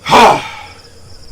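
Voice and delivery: long, heavy, breathy huff; loud exasperated sigh. Acted. Breakbone sighs hard at the meddling adventurers, loud and fed up. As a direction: blow out all the frustration.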